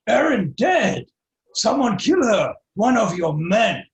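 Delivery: loud, slow, high-pitched half-orc outcry; accusing half-orc. Acted.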